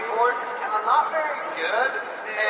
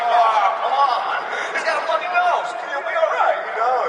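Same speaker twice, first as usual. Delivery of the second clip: exasperated; 7 semitones higher, 6 dB louder than usual. Retold or imitated. Skater protesting to the mom, loud and exasperated. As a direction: plead that it's only a bloody nose. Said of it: "I'm like".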